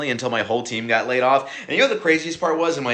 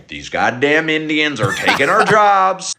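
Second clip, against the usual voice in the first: mock-outraged father, slow and high; hammed-up angry dad rant, stretched out; 4 semitones higher, 4 dB louder, slower than usual. Laid-off comic imitating every one of your fathers, slow mock outrage. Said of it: "turned into every one of your fathers".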